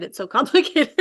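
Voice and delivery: laughing at her own stumble. Real, not acted. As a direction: giggle through the last word. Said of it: "I can't even say it".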